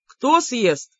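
mildly puzzled query; lightly baffled; bewildered question. A narrator's reading. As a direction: ask with a touch of confusion. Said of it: "растерянно спросил из гостиной брат".

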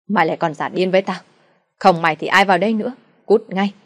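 father shouting his daughter out, fast and furious. Acted. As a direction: drive her out of the house, yelling.

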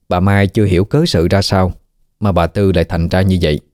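plain reading voice

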